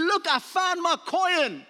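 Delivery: woman calling to her neighbors in slow delighted joy; excited woman. Retold or imitated. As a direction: savor the found coin out loud. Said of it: "calls all her neighbors".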